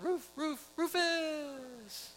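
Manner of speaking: high, sing-song taunt called across the yard; kids taunting. Retold or imitated. Boys taunting the neighbour over the fence, high sing-song. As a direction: chant it like mocking eight-year-olds. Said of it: "we started yelling out".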